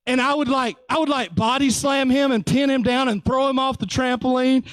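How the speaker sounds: ordinary delivery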